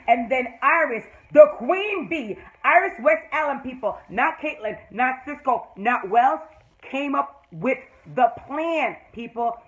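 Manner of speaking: proud fan announcing the queen; loud, drawn-out emphasis on each name; triumphant proclamation. Real, not acted. Proudly proclaims Iris made the plan.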